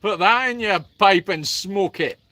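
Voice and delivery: hammed-up Scottish accent with a laugh. Answering praise of their accent, playful put-on Scottish accent. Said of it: "Your Scottish accent is not too bad".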